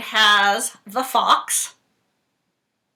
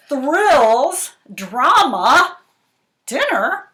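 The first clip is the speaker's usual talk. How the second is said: dramatic blurb; 6 semitones higher, 10 dB louder, much slower than usual. Acted. Dramatic blurb read aloud.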